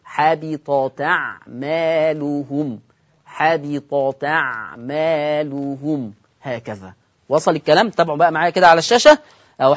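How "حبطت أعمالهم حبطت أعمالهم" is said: حبطت أعمالهم said slowly, the ta of حبطت carrying an open fatha; naql demo. Shown as a demonstration. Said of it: "فتقرأ حبطتا". (نطق حبطت أعمالهم ببطء والتاء مفتوحة)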